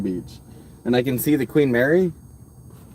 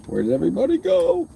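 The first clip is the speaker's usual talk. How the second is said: mock dismay; 10 semitones higher than usual. Streamer whining to the chat about viewers leaving, high and whiny. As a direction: wail it like a hurt cartoon.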